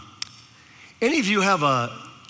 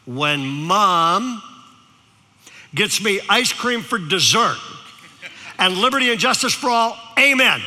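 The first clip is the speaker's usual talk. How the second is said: put-on kid's loud prayer; 2 semitones higher than usual. Boy ends his prayer for ice cream, building loud. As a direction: pray it big and finish with a shout. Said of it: "he goes".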